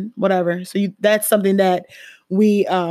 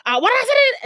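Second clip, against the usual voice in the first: much higher, sharp mock scolding; imitating a yelling mom; 14 semitones higher, 3 dB louder than usual. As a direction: play the mom who snaps at her kid. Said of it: "they gonna yell at you".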